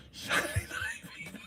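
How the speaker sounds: very high, soft, voiceless laughing squeak; laughing squeal. Real, not acted. Telling viewers what show was seen, laughing squeal. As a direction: squeak the title through a laugh. Repeats title, laughing in delight.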